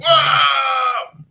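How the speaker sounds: high, loud drawn-out whoa; big stretched-out shout; crowd exclaiming. Acted. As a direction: holler like a bar crowd. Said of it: "a couple people are like".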